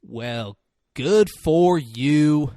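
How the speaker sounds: drawn-out, slow delivery after a long pause; deliberate pause. Retold or imitated. He retells the reply to Marty, pausing and drawn out. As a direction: let each word hang, stretching "good for you". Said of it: "And then he pauses".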